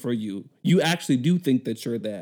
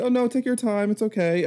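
much higher, syrupy polite phone voice; overly sweet customer service voice; 8 semitones higher than usual. Retold or imitated. Call-center worker brushing off an angry caller, in an overly sweet, much higher voice. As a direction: chirp it like a patient call-center agent.

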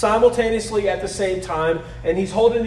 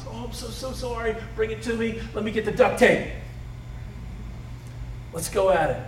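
tender parent; 5 dB quieter than usual. Retold or imitated. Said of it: "we love that child".

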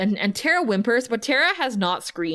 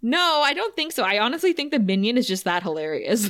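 ordinary talk

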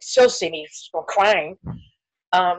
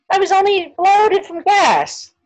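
slow, high and louder, scoffing at the idea; mocking disbelief; 13 semitones higher, 6 dB louder, slower than usual. Telling the host she scoffed at the doctor, in a slow, high mock tone. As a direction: drag it out like the doctor is crazy for worrying. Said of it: "why I'm saying it like this".

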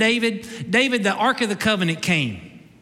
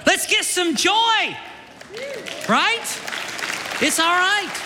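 rousing shout; 10 semitones higher, slower than usual. Real, not acted. Preacher rousing the congregation.

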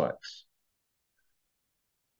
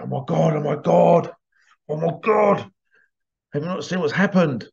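mad; 4 semitones higher than usual. Retold or imitated. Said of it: "And he was mad".

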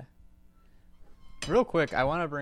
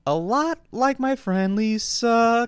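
much higher, slow creepy sweet talk; creepy politician voice; 9 semitones higher, 4 dB louder, much slower than usual. Pretend. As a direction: lay on the unsettling charm slowly. Mocking creepy hug request.

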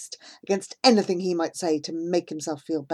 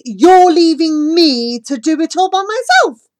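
anger explodes; 8 semitones higher, 12 dB louder than usual. Acted. Girl exploding in rage at the friend leaving her. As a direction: let the rage burst out in a shout. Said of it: "my anger explodes".

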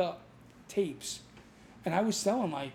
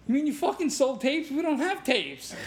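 shocked disbelief; 8 semitones higher, 3 dB louder than usual. Retold or imitated. Buddy Walt alarmed on phone.

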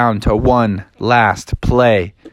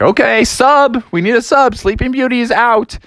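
high-pitched sideline call; urgent team call in a raised voice; calling for a sub; 9 semitones higher than usual. Acted. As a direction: holler for a replacement player. Team calls for substitute.

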